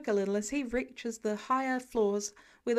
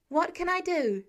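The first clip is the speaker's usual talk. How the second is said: higher, louder eager question; urgent ask; 6 semitones higher, 4 dB louder than usual. A narrator's reading. Peter asking the firemen what he can do, eager. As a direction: ask it keen and ready to help. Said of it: "he asks".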